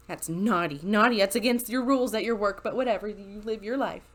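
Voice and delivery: ordinary delivery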